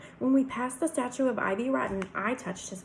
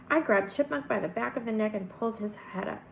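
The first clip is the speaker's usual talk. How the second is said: usual speaking voice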